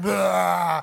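long mock retching noise; imitated vomiting. Preacher mimicking girl throwing up, imitated vomit noise. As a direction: let out a drawn-out pretend heave.

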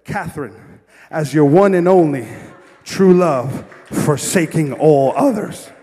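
booming, loud wedding vow; officiant reading vows. Retold or imitated. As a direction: proclaim it phrase by phrase like an officiant. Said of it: "I open up the book and I say".

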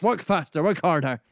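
loud, pushy mock commands; bossy director orders. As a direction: bark it like a slave driver.

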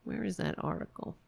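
ordinary talk

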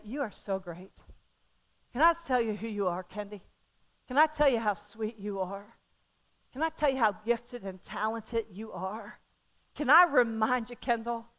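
soft, gentle affirmation; tender mom. Retold or imitated. Mom affirming her daughter, soft and tender. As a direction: soothe her daughter with quiet praise. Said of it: "My job as a mama is to say".

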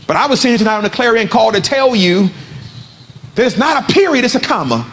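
emphatic preacher's proclamation with high peaks; impassioned preaching. Real, not acted. Preacher declaring the story is not over, impassioned preaching. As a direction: proclaim hope to the congregation.

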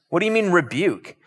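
ordinary talk

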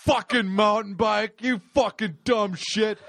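a hammed-up furious drunk; drunk rage. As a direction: bellow it while smashing the bike.

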